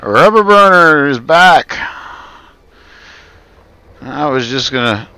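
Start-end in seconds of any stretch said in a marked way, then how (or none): 0.0-1.8 higher, louder and slowed, calling out a welcome; excited greeting. Real, not acted. Streamer greets returning viewer Rubber Burner with an excited call-out. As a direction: welcome the regular back with a bright call.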